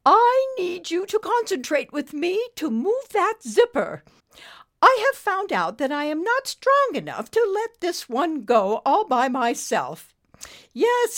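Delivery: put-on high, loud, earnest British accent; grand British urgency; serious British lady. A narrator's reading. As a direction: implore firmly as a stately British dame. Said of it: "then got serious".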